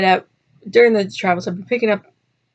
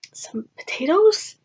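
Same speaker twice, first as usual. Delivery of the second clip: slow, higher whispered ask; whispered request; 10 semitones higher, slower than usual. Retold or imitated. Soldier coaxing villagers for potatoes, slow hushed whisper. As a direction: ask sheepishly under the breath.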